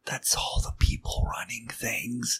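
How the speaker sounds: conspiratorial whisper. Acted. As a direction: breathe it like a secret. Conspiratorial aside, unvoiced.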